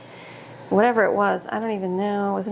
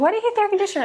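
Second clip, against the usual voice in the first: high, puzzled retold question to dad; incredulous question; 12 semitones higher, 3 dB louder, much faster than usual. Retelling asking her dad about the air conditioner, high incredulous. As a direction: ask it like it makes no sense.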